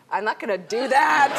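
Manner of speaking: loud laughing protest, voice jumping up; laughing refusal. Real, not acted. Actress laughing as the host asks her to mime milking a cow. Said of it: "Okay, here you go".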